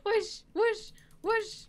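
high airy whoosh; whooshing sound. Pretend. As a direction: make the whoosh noise. Imitating flying past.

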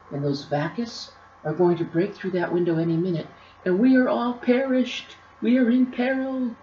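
high, dramatic exclamation; exclaiming. A narrator's reading. Artie warning the attic keeper of peril, exclaiming. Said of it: "she exclaimed".

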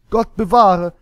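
loud, high cry of alarm; alarmed scream. A narrator's reading. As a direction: shriek it out like startled girls. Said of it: "schrien die Mädchen".

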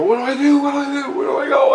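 high, loud panicked wail; freaking out. Retold or imitated. Freaking out as Tina yells at me, high panicked cry. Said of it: "I'm freaking".

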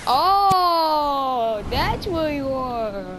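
high, slow creepy taunt; playful high-pitched menace; put-on high voice. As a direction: sing it up high like a stalker. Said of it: "Why am I speaking like this?".

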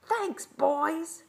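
high, loud sing-out; gloating thanks. A narrator's reading. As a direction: call it out like a girl who has won. Girl gloats after tricking boys.

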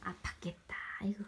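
soft whispered sympathy. Real, not acted. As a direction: breathe it gently. (속삭이며 안쓰럽게)

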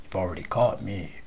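quiet, to himself; said under his breath. A narrator's reading. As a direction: murmur it privately, aside. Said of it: "saying to himself".

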